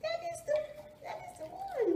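high squeaky praise voice; squeaky praising a dog. Real, not acted.

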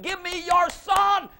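loud, slow, high booming command; voice of God commanding. Acted. Preacher voicing God asking Abraham for his son, booming. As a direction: boom it out as God demanding the son.

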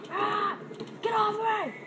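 high panicked yell at attacker; panicked scream. Real, not acted. Attacked in game.

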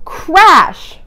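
loud, high crash; thunder crash. Acted. Voicing a near thunder crash loudly for children. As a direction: burst out with the crash.

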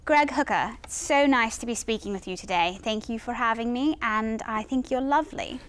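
British royal accent, graciously put on; Kate Middleton. Shown as a demonstration. Guest saying the host's name on request, British accent. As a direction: greet him in a polite British royal accent. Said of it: "saying my name in that accent".

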